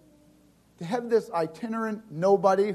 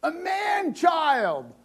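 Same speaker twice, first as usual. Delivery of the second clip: much higher, slow, drawn-out joyful cry; triumphant shout; 10 semitones higher, much slower than usual. Retold or imitated. Father announcing his newborn heir, a slow triumphant cry. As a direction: proclaim it like a proud king. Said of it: "he looks up and he holds it and he goes".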